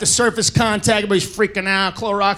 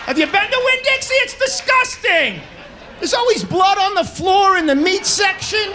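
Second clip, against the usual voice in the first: disgusted rant; 8 semitones higher than usual. Acted. Comic to the crowd about Winn-Dixie, high disgusted yell. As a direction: yell it out like the filth is right in front of you.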